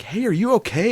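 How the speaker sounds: high-pitched, concerned Midwestern check-in; Midwest niceness. Retold or imitated. Passersby checking on someone lying in the grass, Midwest niceness. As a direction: imitate an overly nice passerby. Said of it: "this is Midwest niceness".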